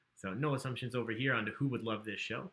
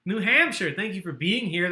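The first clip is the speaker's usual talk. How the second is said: louder, higher welcome; excited greeting; 4 semitones higher, 6 dB louder than usual. Real, not acted. Host welcomes a player from New Hampshire, excited. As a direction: cheer the state out to the player.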